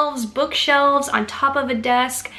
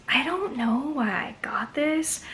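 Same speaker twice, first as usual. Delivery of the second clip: whispered aside; 5 semitones higher, 5 dB quieter than usual. Real, not acted. Whispered aside about purchase.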